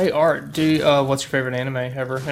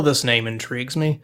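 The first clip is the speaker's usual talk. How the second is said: ordinary talk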